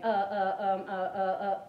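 "Uh, uh, um" repeated loudly on a level tune; string of fillers. Shown as a demonstration. Coach imitating a speaker stuck on fillers, loud repeated "uh" and "um". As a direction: punch out each "uh" and "um" evenly. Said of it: "when you have a filler".

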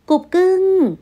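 high, loud sugary cooing call; flirty rich woman. Acted. Lover waves at Tuấn from her car, high sugary call. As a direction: call out to your darling sweetly. Hà greets from supercar.

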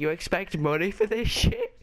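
high, loud disbelieving shout; outraged yell. Acted. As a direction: yell at the cheeky applicant. Boss throws applicant out.

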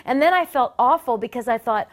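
ordinary talk, nothing marked

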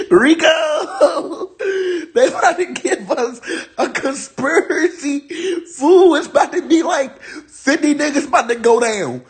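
high-pitched laugh-talk, cracking up; laughing hard. Real, not acted. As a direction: blurt it out through real laughter. Laughing at conspiracy news.

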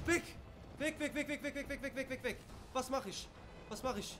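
high, frantic, rapid-fire words; panicked. Real, not acted. Streamer fleeing a zombie, panicked high chatter. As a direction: scramble away in real panic. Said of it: "ich hab mich grad übelst erschreckt".

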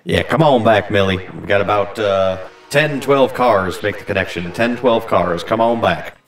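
loud, clipped radio call; walkie-talkie voice. Pretend. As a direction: call it over the radio to the yard. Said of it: "use the walkie-talkie voice".

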